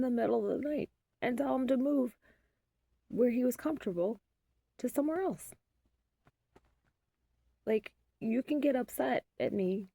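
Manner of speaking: exasperated mom arguing her side, voice climbing; heated rant. Real, not acted.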